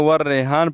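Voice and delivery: والریحان read quickly as "war-rehaan", plain h; mistaken flat vowel. Retold or imitated. Teacher imitating everyone's misreading, flat "reh". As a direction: shorten والریحان to "reh" with a light h. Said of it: "سب لوگ پڑھتے ہیں".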